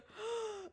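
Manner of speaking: high excited gasp. Acted. Recognizing her friend Judy.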